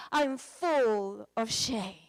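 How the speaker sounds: slow, teary retelling of her words; tearful confession. Retold or imitated. Pastor retelling the wife's tearful words, slow and teary. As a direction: let her shame break through, slowly. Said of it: "started crying".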